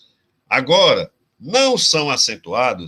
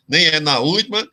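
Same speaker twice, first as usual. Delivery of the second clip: ordinary talk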